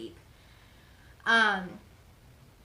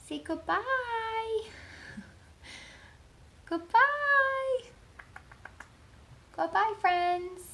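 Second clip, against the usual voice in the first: much higher and louder sing-song goodbye; 6 dB louder, much slower than usual. Real, not acted. Owner prompting her dog to wave goodbye, squeaky baby talk. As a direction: squeal it out like for a puppy.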